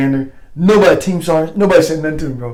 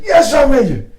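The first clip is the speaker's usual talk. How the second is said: high, retold military acknowledgement; called-out reply; 10 semitones higher, 4 dB louder, slower than usual. Soldier answering the sergeant major across the lot, calling out. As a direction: call back like a soldier at attention.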